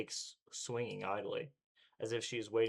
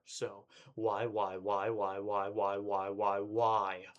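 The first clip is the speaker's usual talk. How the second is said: building louder panicked whys; frantic repetition; 4 dB louder, much slower than usual. Acted. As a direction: hammer the why, louder each time. Breaking down, rising panic.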